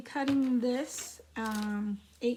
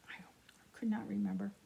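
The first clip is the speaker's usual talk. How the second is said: breathy whispered aside; 5 dB quieter, faster than usual. Real, not acted. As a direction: whisper it to yourself. Self-aside after forgetting a name.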